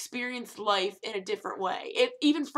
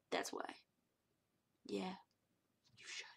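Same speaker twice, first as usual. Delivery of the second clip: whispered very softly, with long pauses; hushed aside; 2 semitones lower, 18 dB quieter than usual. Pretend. Telling viewers why they should keep watching, whispered. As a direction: drop to a tiny, deliberate whisper.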